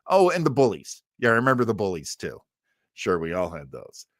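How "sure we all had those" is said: ordinary delivery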